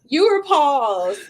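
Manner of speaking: slowed, warped call audio; dragging laggy connection; slow motion glitch. Real, not acted. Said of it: "Y'all sound like slow motion".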